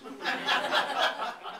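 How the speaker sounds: high breathy laugh. Real, not acted. Laughs at carnage line.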